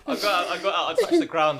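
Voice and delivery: normal talking voice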